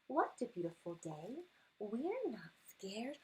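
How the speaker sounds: ordinary delivery, nothing marked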